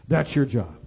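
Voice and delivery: low, soft, matter-of-fact handing over; quiet charge. Retold or imitated. Finance minister telling a religious leader his duty, quietly. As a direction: lay the duty down softly and low.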